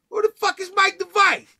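loud, high, suspicious challenge through the door; a paranoid dealer yelling at a stranger at his door; hostile yell. Retold or imitated. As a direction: bark it at the stranger knocking. Stranger yells through hotel door.

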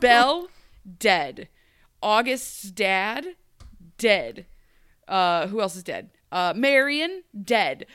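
comic deadpan tally of the dead; loud deadpan roll call. Real, not acted. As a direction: punch every dead like a verdict. Listing deaths in loud deadpan.

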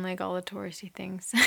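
soft whispered aside; whispering. Real, not acted. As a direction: whisper it like a secret.

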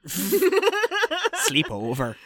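stretched, slow, laughing through it; laughing kid voice. Retold or imitated. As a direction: drag it out while laughing like a giddy kid. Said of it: "they kind of like".

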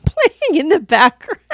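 breaking up into laughter mid-sentence; laughing. Real, not acted. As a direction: talk through a rising laugh. Cracking up over [name].

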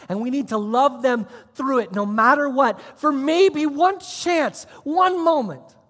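loud, high, slow peak; preaching at full force. Acted. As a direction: plead with the room, stretching the words. Preaching climax.

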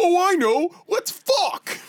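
very high, loud squeaky voice. Pretend. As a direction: shriek it way up high. Imitating meme character, very high.

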